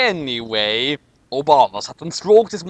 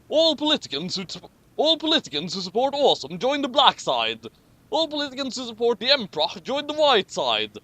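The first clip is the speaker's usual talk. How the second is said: grand announcement; 7 semitones higher than usual. Acted. Obama announcing the sides.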